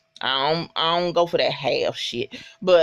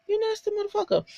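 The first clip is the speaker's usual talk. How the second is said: much higher and softer on You; squeaky insult; 5 dB quieter, much faster than usual. Retold or imitated. Viewer to the coach on screen, high mock disgust. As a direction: pitch it way up in mock outrage. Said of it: "And I was like".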